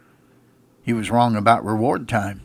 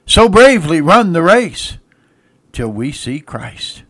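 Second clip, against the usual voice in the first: slow, swelling hymn recitation; recited hymn; 3 semitones higher, 6 dB louder, slower than usual. Acted.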